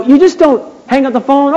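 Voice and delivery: ordinary talk, nothing marked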